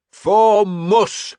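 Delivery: high, loud bellow rising on the end; bellowed war cry. A narrator's reading. Leader offering his men a war cry, bellowed. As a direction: give them a new battle cry. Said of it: "I bellowed".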